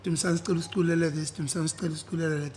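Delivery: rushed, eager classmates asking; quick pleading voice; fast quoted request. Retold or imitated. Voicing classmates asking for songs.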